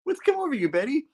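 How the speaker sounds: high squeaky disbelief; comic falsetto questioning; mock dismayed hero. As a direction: plead in a silly high-pitched character voice. Acting Peter's shocked question to Betty.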